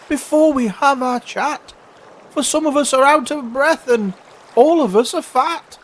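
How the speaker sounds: very high, loud little-creature voice; crying oysters. Acted. Out-of-breath oysters asking for a rest, high protest. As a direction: plead in a high pipe. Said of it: "the oysters cried".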